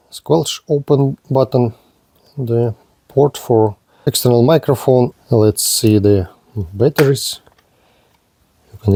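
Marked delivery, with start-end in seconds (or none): none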